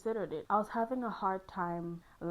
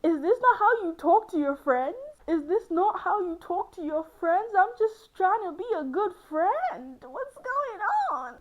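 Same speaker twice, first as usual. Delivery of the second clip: mock pleading whine; 10 semitones higher, 6 dB louder than usual. Real, not acted. High pleading, laughing aftermath.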